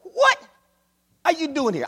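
a hint of an indignant prophet; Elisha confronting the king. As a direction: call out the king to his face. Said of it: "look at what he says to the king".

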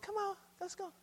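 high, soft mother's voice calling him away; a mom gently urging her boy to leave; mother calling her son. Retold or imitated. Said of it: "And his mama said".

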